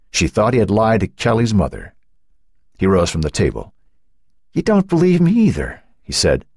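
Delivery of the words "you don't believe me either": high, hurt accusation; upset teenager, pitch raised; voice rising. A narrator's reading. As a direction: protest in wounded disbelief. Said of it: "His voice rose".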